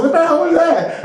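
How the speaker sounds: high-pitched, incredulous outburst; mock astonishment. Limo clerk baffled by the caller, mock astonishment. Acted shocked reaction to caller.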